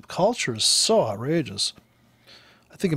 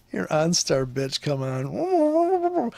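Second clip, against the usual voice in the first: mock taunt; 3 semitones higher, much slower than usual. Driver taunting the OnStar assistant, mock taunt.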